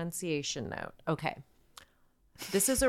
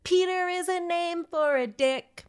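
high, excited goofy rant; goofy hyped teasing about the name; hyped silly rant; 6 semitones higher than usual. Pretend. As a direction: ramp up the silliness, voice high.

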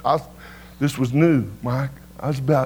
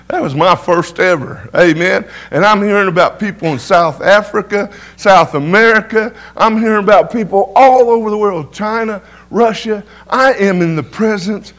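building, loud and high, rising fervor; preaching at full force; 6 semitones higher, 8 dB louder than usual. Acted. Preacher to congregation, loud building fervor. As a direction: lift the room with each place name.

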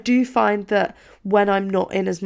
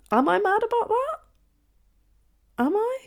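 high, puzzled inner voice, much higher; mock self-questioning. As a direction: ask yourself in a squeaky high voice. Questioning herself as a younger self.

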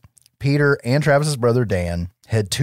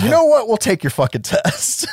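defiant shout; 11 semitones higher than usual. Retold or imitated. Mike shouting down the town meeting, defiant. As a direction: throw it back at the mob. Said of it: "And he says".

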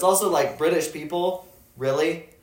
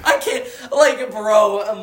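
laughing; 7 semitones higher, 5 dB louder, much slower than usual. Real, not acted. Laughing hard.

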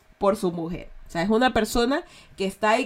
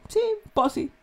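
casual posi; 11 semitones higher than usual. Shown as a demonstration. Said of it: "la variación de posi cambia".

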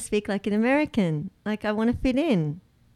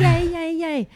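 mock cheer; 9 semitones higher, 4 dB louder, slower than usual. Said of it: "So I'm just like".

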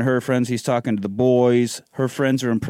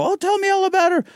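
high, gushing mom voice; doting mother; faster than usual. Retold or imitated. Mother asking son about his new girl, high and gushing. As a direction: coo it like an eager mother.

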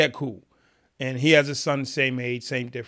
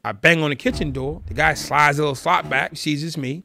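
usual speaking voice

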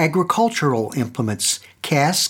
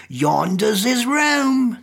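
slow, higher grinning servant; sly servant voice; 7 semitones higher, slower than usual. A narrator's reading. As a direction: point the way with a smirk. Servant points to master's room.

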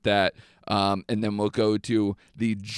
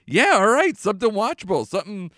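eager hypothetical quote; 7 semitones higher than usual. Pretend. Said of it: "we could be like".

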